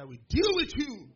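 loud, high acted threat. Big student threatening him, loud mock anger. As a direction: mimic an angry bully's threat.

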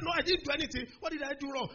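high-pitched pleading denial; innocent protest. Retold or imitated. Young student denying any wrong, high protest.

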